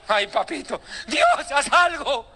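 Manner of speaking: slow, laughing mock plea; Satan begging God. Preacher voicing a panicked Satan begging God, slow and laughing. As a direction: beg heaven in hammed-up dread, dragging it out. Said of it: "reacción posible de Satanás".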